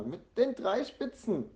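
put-on high, slow child's insistence; insistent child's call. A narrator's reading. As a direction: draw it out high and emphatic. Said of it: "ruft Jana".

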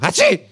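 high, sudden spoken sneeze; acted sneeze. Sick kangaroo interrupting the story, acted high sneeze. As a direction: burst out a squeaky mock "hatschi". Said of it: "macht das Känguru".

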